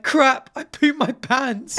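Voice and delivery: high, slow mock voice of the wife; wife's panicked line. Retelling the wife's fall, slow high mock wife voice. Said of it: "she said something like".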